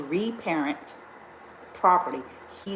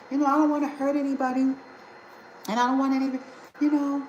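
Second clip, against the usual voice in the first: high, fast, timid mocking voice; meek people-pleaser; 9 semitones higher, much faster than usual. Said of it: "I know people be like".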